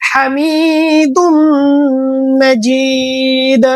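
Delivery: হামিদুম মাজিদ with the vowels drawn out and the m nasalised; হামিদুম মাজিদ slowly, long vowels and held nasal; elongated recitation. Shown as a demonstration. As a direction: stretch the madd in মাজিদ and hum the m. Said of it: "মদ মাখরাজ গুন্না টেনে পড়তে হবে".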